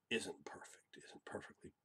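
soft whisper. Shown as a demonstration. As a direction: keep it hushed. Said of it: "talk real low".

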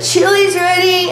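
high-pitched mealtime call; re-enacted high call to the household; singsong call. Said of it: "I was in here and I said".

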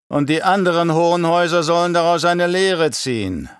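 high, measured Baron voice; herrischer Baron, selbstgefällig. Acted. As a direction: pitch it up and declaim the plans like a scheming baron. (hohe, getragene Baronstimme, selbstgefällig)

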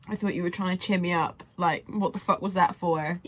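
ordinary talk, nothing marked

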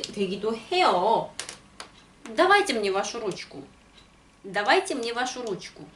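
ordinary talk

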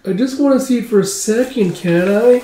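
put-on high childlike wheedling; pleading child. Child asking for a quick look at the hawk, pleading child.

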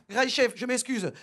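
normal talking voice

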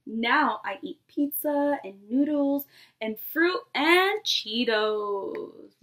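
lively picture-book read-aloud to a class; storybook voice for a nursery class; reading to children. Acted. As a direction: read it like story time for little kids. Teacher reads picture book aloud.

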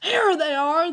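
high, loud cry of alarm; yelled. A narrator's reading. Old tramp alerting his friends as boys escape, yelled. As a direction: holler it to summon the others. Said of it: "the old tramp yelled".

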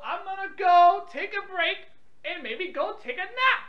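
ordinary talk

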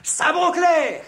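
rally the charge, full voice; battle cry. Acted.

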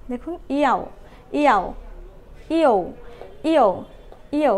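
ইয়া, ইয়াও, ইয়ো each said alone, clearly; Chinese finals. Shown as a demonstration. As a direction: model the i-finals one by one. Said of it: "তাহলে মিলে হয়ে গেল".

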